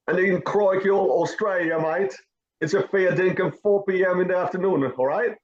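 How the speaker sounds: high, hammed-up Aussie accent; mock Australian accent. Host shows off his Australian accent to co-host, mock Aussie twang. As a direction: lay on a cartoon Australian accent. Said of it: "how's my, uh, Australian accent".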